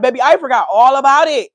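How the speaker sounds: loud, high, worked-up telling; excited. Real, not acted. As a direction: exclaim it, fired up. Excited retelling of border stop.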